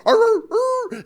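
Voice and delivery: high-pitched excited yapping; high mock puppy yelps; imitated dog bark.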